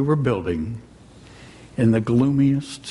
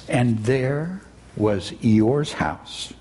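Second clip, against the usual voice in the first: ordinary delivery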